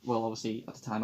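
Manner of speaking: ordinary talk, nothing marked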